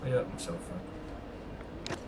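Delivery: whispered. Real, not acted. Gamer hurt himself, whispered under his breath. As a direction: mutter it to yourself after the knock.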